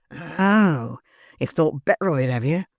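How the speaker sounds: a hint of a gruff growl; lightly voiced surly man; Sikes growling. A narrator's reading. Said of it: "growled Sikes".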